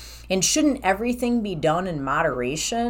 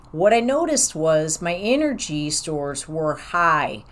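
ordinary delivery

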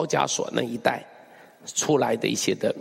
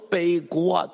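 ordinary delivery

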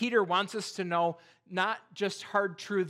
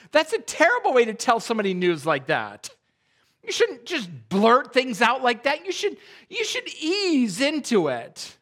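indignant scolding; 5 semitones higher, 6 dB louder than usual. Retold or imitated. Man scolds brother for bluntness.

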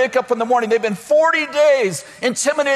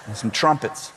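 much lower and softer, dropping off; low quiet God; 12 semitones lower, 6 dB quieter than usual. Retold or imitated. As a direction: let God's plan trail off low. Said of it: "God goes".

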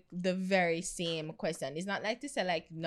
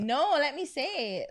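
ordinary talk

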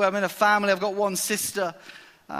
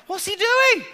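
pitched way up in mock bafflement; baffled high squeak; 14 semitones higher, 4 dB louder than usual. Preacher voices his own puzzlement.

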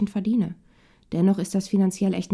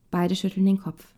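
plain reading voice

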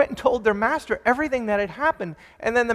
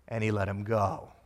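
"and he let him go" much lower and softer, ending on a rise at "go"; soft emphasis; 9 semitones lower, 8 dB quieter than usual. Shown as a demonstration. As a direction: let "go" fall to a hush then lift. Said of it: "here comes the key phrase".

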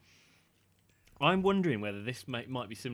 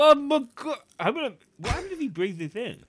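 alarmed surprise; 12 semitones higher, 7 dB louder than usual. Real, not acted. Candy popping, alarmed.

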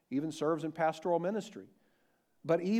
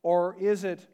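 usual speaking voice

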